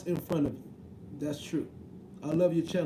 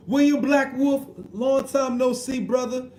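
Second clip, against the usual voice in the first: loud greeting; 5 semitones higher, 6 dB louder than usual. Real, not acted. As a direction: call out to him like you spotted him across a hall. Greeting a viewer in chat.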